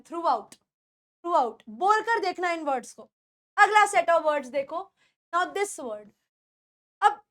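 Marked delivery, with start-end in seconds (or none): 0.0-1.6 "throughout" without lengthening the oo; short oo. Shown as a demonstration. Teacher models throughout for learners, short oo. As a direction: keep oo brief in throughout, into "out". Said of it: "ऊ बड़ा नहीं है".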